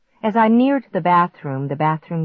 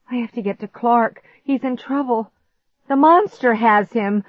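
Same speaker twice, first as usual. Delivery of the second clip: higher, urgent voice; alarmed realization; 6 semitones higher, 4 dB louder than usual. A narrator's reading. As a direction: realize with alarm he needs rescue. Alarmed realization that Clark is in danger.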